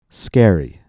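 "Scary" with a strong first vowel, then falling; stress on first syllable. Shown as a demonstration. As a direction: lean hard on the start of "Scary". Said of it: "Notice how the first syllable".

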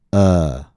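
"Uh" met een ontspannen neutrale tong; "Uh" kort, tong in het midden; uh vowel. Shown as a demonstration. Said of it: "Please listen and repeat".

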